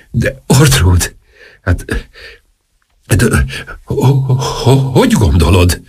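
halting, flustered stutter with long gaps; stammering. A narrator's reading. Flustered king resisting the duchess's coaxing, stammering. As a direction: trip over the first syllables, unsure.

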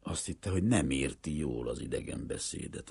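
usual speaking voice

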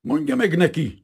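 high, loud sudden order; angry outburst. A narrator's reading. Enraged American instructs interpreter, flaring up. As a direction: flare up at the interpreter. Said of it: "csattant föl a hangja".

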